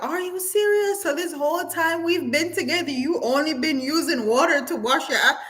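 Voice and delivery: high, stunned disbelief; shocked girlfriend. Retold or imitated. Retelling the girlfriend's shock at her man, high-pitched disbelief. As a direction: let the disbelief climb high. Woman confronting boyfriend on video.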